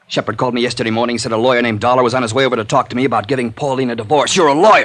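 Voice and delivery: fast, loud accusation peaking in a yell; jealous husband accusing the investigator, shouting; rising fury. Acted.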